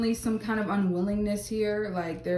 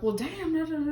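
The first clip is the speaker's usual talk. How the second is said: mock upset; 8 semitones higher, faster than usual. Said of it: "like, him being upset".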